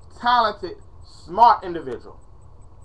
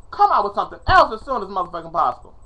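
ordinary talk, nothing marked

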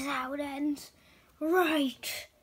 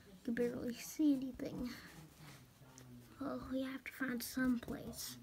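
anxious whisper; 9 dB quieter than usual. Acted. Lost in a blurry place.

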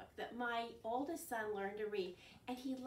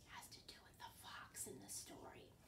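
secret whispered hint; 16 dB quieter than usual. Acted. Teacher whispering a hint about the fox.

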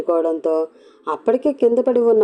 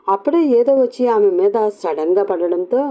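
ordinary delivery, nothing marked

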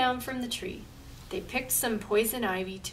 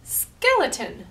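loud, high booming witch; 4 semitones higher, 8 dB louder than usual. Acted. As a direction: boom it out. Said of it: "boomed Big Witch".

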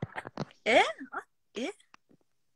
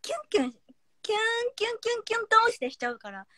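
gushing; 7 semitones higher than usual. Pretend. Gushing little sister act.

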